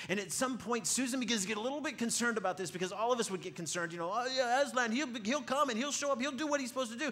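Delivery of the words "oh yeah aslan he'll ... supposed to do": breezy reassurance. Acted. Kids reassure Susan about Aslan.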